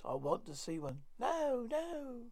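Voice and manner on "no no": witch voice. Acted. Witch protests.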